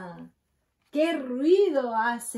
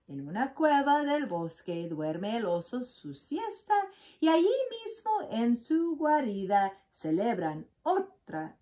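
ordinary delivery

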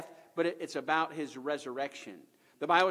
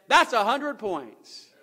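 loud, drawn-out, higher-pitched proclamation; triumphant announcement; 5 semitones higher, 7 dB louder, much slower than usual. Acted. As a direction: proclaim it like the winning score. Pastor's climactic verdict in the story.

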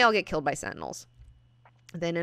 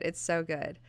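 the repeat dropped much softer, to herself; softer echo; 6 dB quieter than usual. Real, not acted. Fan repeating her praise to herself, softly. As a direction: say it again quietly, savouring it.

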